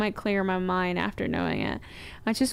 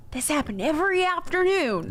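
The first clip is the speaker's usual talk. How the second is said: high-pitched send-up of a dramatic reader; mocking storytelling voice; 9 semitones higher than usual. Co-host teasing the reader about his delivery, mocking storytelling voice. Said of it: "Something other than".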